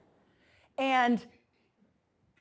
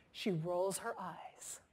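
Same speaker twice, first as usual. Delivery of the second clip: hushed whisper; 5 semitones lower, 13 dB quieter, much slower than usual. Retold or imitated. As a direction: drop to a voiceless whisper, as if confiding. Employee's complaint confided to the room.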